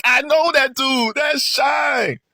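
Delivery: high, loud, thrilled call-out; excited shout. Real, not acted. Fan calling out that it's the old Shine, excited shout. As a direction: shout it like you've found him.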